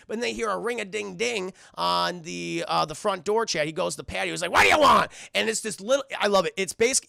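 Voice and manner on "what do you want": loud, high cartoon bellow; angry yell. Eustace answering the door, angry yell. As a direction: yell rudely at whoever is knocking.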